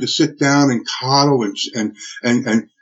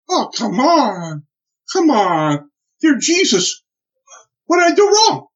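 anguished plea; 10 semitones higher, slower than usual. Retold or imitated. Anguished cry at wife's crash.

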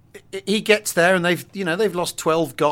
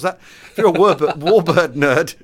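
louder, quicker, breaking into a laugh; laughing retry; 5 dB louder than usual. Real, not acted. Podcaster retrying a tongue-twister, amused and laughing. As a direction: have another go, laughing at your own stumble.

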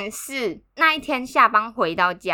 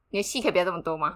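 fast, quieter, deadpan retort; calm deadpan; 7 semitones lower, 7 dB quieter, much faster than usual. Retold or imitated. Partner telling the crying narrator to stop the drama, fast cool deadpan. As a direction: brush off the drama coolly. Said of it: "他就很冷静的看着我说".

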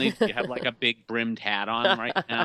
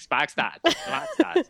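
put-on Boston accent, dropped R's, animal call; heavy Boston accent; 7 semitones higher, slower than usual. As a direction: call it out like a wild Boston creature. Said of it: "I sound like some type of animal".

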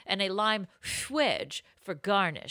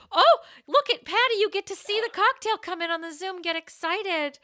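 high, squealing excitement; excited; 11 semitones higher, 4 dB louder than usual. Real, not acted. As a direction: show it off in a high, thrilled voice. Excitedly showing off the cocktail.

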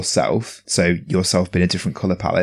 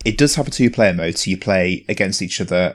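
ordinary delivery

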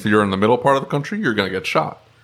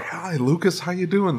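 higher, chipper mock greeting of a conference guest; friendly greeting; 7 semitones higher, 4 dB quieter than usual. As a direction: greet a familiar face brightly, a bit put on. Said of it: "they're like".

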